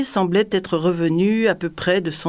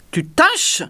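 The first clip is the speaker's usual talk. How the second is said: high-pitched, incredulous outburst; ferocious cry; 10 semitones higher than usual. A narrator's reading. Tyrant rounding on the dazed dwarf, ferocious. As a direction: throw it back at him fiercely. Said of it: "cria le tyran férocement".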